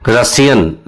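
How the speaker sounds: ordinary talk, nothing marked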